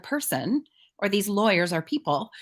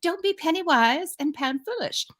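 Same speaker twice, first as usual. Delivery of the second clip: put-on whispered, higher-pitched English accent; 7 semitones higher than usual. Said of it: "this is my best accent".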